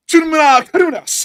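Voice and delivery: high, loud mock Korean gibberish; north korean newsreader. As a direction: belt fake Korean like a state anchor. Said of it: "Let me really sound like the North Korean news lady".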